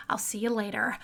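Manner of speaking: breathy unvoiced goodbye; whisper. Real, not acted. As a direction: whisper it softly. Soft sign-off whisper.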